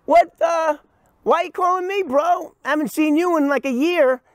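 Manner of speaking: high, loud, surprised hello; answering the friend's sudden call, high and surprised; surprised greeting. Retold or imitated. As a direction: act thrown by the unexpected call. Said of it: "I go".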